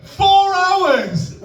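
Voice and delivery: loud, very high incredulous yell; outraged shout. Acted. Comic at peak of cooking rant, outraged shout.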